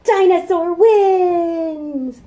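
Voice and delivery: high, loud, slow victory call; triumphant shout. Acted. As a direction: announce the winner, huge. Triumphant story refrain.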